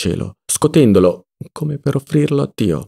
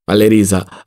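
usual speaking voice

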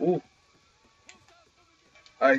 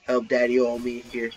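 normal talking voice

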